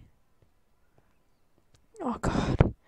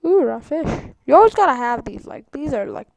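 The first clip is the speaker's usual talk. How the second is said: ordinary talk, nothing marked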